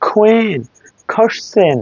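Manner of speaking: "কুইন, কোশ্চেন" with a puff on each k; separated model words "কুইন, কোশ্চেন", airy k; aspirated English Q. Shown as a demonstration. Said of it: "কেউ না".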